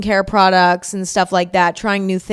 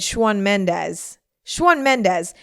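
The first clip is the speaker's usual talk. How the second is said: put-on New York accent with a rounded "Shawn"; much slower than usual. Host re-enacting the end of her Met Gala interview, New York accent. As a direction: say the name like an exaggerated New Yorker. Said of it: "like, a New York".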